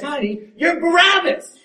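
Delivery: high, drawn-out imitated shout; excited shout. Wife blurting the wrong Bible name, shouted excitedly. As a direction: shout it like a sudden revelation, stretching it out. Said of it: "she shouts".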